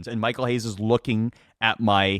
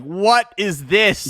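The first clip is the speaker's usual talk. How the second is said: mock shock; 8 semitones higher, 5 dB louder, much slower than usual. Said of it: "you're like".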